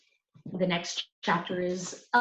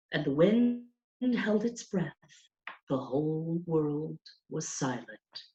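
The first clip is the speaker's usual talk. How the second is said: a reverent hush building then falling; rapt storytelling; 5 semitones higher, 4 dB louder than usual. A narrator's reading. Teacher telling Mario of Orpheus, rapt and reverent. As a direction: tell the legend in wonder.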